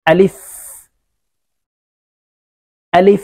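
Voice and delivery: আলিফ clipped short with a breath of air; quick আলিফ, no drawn-out vowel; short alif. Shown as a demonstration. Quran teacher repeating আলিফ short for students. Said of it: "টান হবে না, বাতাস বের হবে".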